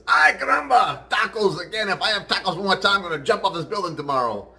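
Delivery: high, loud joke voice for a fed-up Spanish worker; exasperated Spanish guy. Acted. Spanish guy opens his lunch on the roof, exasperated. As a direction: play a Spaniard exploding over his lunch. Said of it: "The Spanish guy opens his lunch and says".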